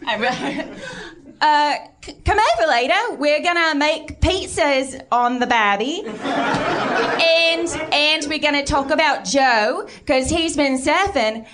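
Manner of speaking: high-pitched, laughing Australian accent; chirpy Aussie neighbour calling over the fence. Shown as a demonstration. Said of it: "Can I hear your really good Australian accent".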